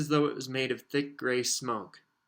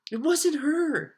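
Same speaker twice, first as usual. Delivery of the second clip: put-on nervous girl; 7 dB louder, much slower than usual. Hermione nervously defends Winky.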